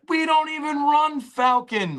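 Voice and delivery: high, slow disbelieving coach voice; incredulous coach. Retold or imitated. Coach to player after a made-up call, stunned and incredulous. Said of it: "he's like".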